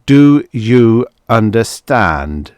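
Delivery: husband insisting on obedience; harder demand. Acted. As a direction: press it on her slowly.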